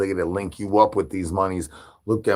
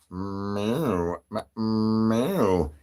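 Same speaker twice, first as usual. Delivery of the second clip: cat meow; 6 dB quieter, much slower than usual. Pretend. Streamer meows like a cat.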